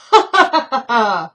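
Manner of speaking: a big hearty laugh at his own joke; loud, self-satisfied laughter; loud long laugh. Acted. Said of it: "he laughs long and loud at his own joke".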